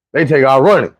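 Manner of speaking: ordinary delivery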